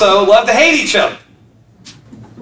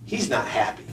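put-on very soft, much lower small child's voice; 10 semitones lower, 13 dB quieter than usual. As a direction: answer softly like a shy little boy. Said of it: "child Hunter answering grandpa".

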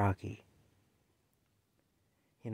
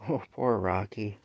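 normal talking voice

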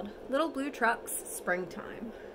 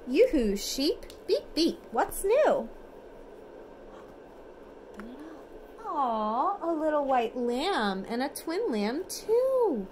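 lively storybook reading, sing-song; reading to children; 4 semitones higher than usual. Acted. Parent reads bedtime picture book to toddler, lively sing-song. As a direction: read it brightly for a toddler at bedtime.

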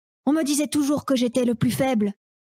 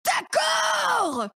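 triumphant shout; 6 semitones higher, slower than usual. Acted. Triumphant decision after being rushed.

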